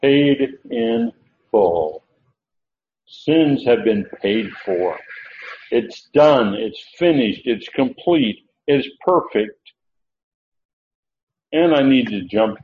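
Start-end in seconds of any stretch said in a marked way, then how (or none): 0.0-9.7 slow, weighty, each short phrase set apart; slow emphatic declarations. Acted. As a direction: land every word like a verdict. Preacher savouring finished atonement.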